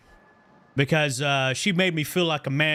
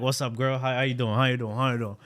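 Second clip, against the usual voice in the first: smooth talker; 5 semitones lower, faster than usual. Retold or imitated. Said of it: "'Cause I was feeling myself".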